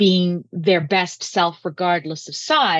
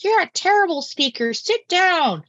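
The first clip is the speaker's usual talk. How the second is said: mocking grade-one teacher; 10 semitones higher than usual. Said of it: "your little grade one teacher was like".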